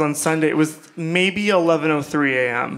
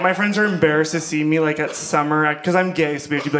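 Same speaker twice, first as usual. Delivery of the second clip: usual speaking voice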